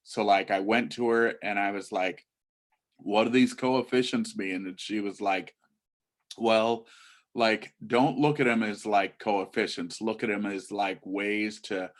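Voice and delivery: put-on low-energy teen rambling full of filler likes; monotone teen run-on; teenager. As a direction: imitate a teenager chatting loosely about class. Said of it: "And this is a teenager".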